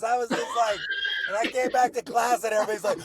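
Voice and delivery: high, loud mocking classmates; excited teasing. Classmates teasing him on his return to class, loud mocking. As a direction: ham up the jeering classmates.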